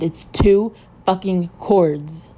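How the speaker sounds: loud, slow, emphatic rebuke; exasperated demand. Acted. Best man grabbing the band leader, loud exasperation. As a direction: spell it out slowly and forcefully.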